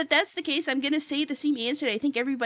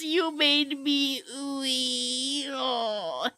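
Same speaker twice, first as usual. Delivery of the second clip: choked up; much slower than usual. Real, not acted. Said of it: "I have tears in my eyes".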